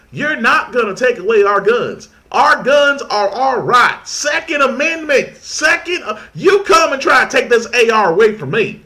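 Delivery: loud, high hammed-up Southern gun-owner voice; mock country accent. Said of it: "I put a country accent on it".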